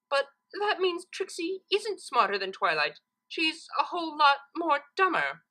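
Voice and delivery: high, proud and oblivious; a boastful unicorn missing the point; Trixie voice. A narrator's reading.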